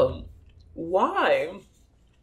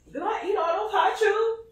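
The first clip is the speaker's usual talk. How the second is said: tearful; 10 semitones higher, 7 dB quieter than usual. Real, not acted. Teary at running out of candy.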